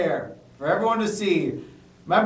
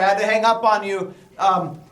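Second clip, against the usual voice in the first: usual speaking voice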